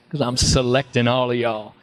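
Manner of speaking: cocky Southern swagger, imitated; Matthew McConaughey. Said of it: "Matthew goes like that".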